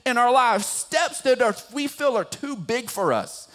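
high, energetic exhortation; impassioned preaching. Real, not acted. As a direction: urge the congregation on with conviction. Preaches application at full force.

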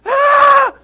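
a sudden high, loud shriek of alarm; panicked scream. Retold or imitated. Son imitating dad's scream in the car, loud shriek. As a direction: shriek like a near crash. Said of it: "And so I'm screaming".

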